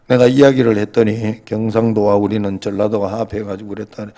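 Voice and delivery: normal talking voice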